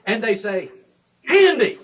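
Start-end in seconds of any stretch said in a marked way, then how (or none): 1.3-1.8 high, loud, wide-eyed exclamation; gleeful high cry of delight; excited little girls. Acted. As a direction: shout it like kids spotting a bag of sweets. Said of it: "their eyes get big as saucers".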